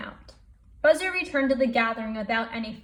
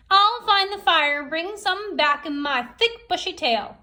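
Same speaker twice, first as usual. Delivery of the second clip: higher, louder proud Possum; Possum boasting; 6 semitones higher, 5 dB louder than usual. Acted. Possum volunteers to the gathering, boastful. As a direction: puff up like a boastful hunter. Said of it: "said Possum".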